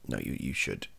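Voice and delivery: ordinary talk, nothing marked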